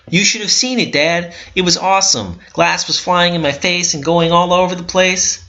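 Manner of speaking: put-on high, eager child voice; a thrilled little boy gushing about the glass; excited kid. Little boy gushing to his dad, high and excited.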